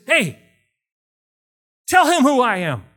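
urgent loud appeal; urgent call. Retold or imitated. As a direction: grab his arm and call out. Grabbing the guy's arm.

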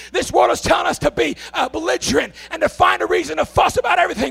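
loud, high, shouting preacher's cry; preaching at full force. Acted. Preacher shouting gratitude to his church, full force. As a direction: pour it out over the crowd, full throttle.

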